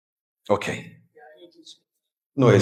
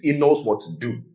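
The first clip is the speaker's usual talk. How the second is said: ordinary talk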